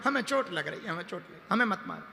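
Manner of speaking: quiet, whimpering complaint; mock tearful. Crying stone tells the sculptor it hurts, mock-tearful. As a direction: plead softly as a pretend crybaby. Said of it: "भाई रो क्यों रहे हो?".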